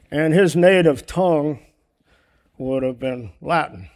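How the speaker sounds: ordinary talk, nothing marked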